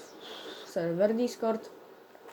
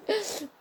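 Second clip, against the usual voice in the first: short laugh; 11 semitones higher than usual. Real, not acted. Laughs after a kill.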